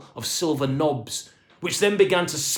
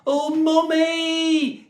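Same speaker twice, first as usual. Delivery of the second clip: high, drawn-out wail; panicked little boy crying for his mother; 5 dB louder, much slower than usual. A narrator's reading. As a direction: wail for mummy in fright. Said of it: "he cried".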